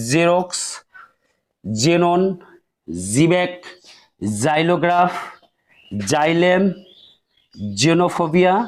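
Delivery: জেরক্স, জেনন said with a z onset; each word spaced out and modelled; teacher demonstrating z sound; initial x as z. Shown as a demonstration. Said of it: "জ উচ্চারণ করতে হবে".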